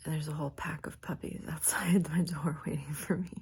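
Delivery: soft whisper just after waking. Real, not acted. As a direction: whisper so the dogs stay asleep.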